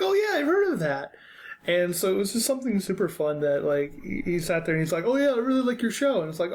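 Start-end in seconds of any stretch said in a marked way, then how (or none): 0.0-1.1 put-on much higher, eager kid voice; squeaky excited fan; high-pitched fan. Fan voiced in pool story. 5.0-6.2 excited fan. Retold or imitated. Fan voiced in pool story.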